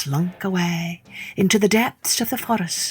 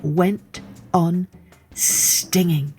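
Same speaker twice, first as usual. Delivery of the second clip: plain reading voice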